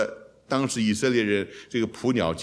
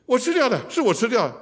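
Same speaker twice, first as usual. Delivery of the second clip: voiced student; 10 semitones higher than usual. African students answering, voiced.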